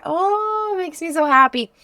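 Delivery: high, gushing with delight; excited. Real, not acted. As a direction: swoon over the colors. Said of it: "I'm so excited".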